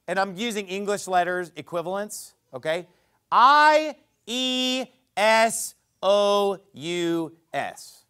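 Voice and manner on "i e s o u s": slow letter-by-letter spelling I E S O U S; spelled out letter by letter. Shown as a demonstration. Preacher spelling the Greek name slowly for the congregation. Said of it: "Let me just spell it for you".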